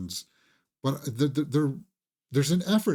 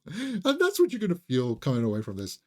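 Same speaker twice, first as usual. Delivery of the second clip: ordinary talk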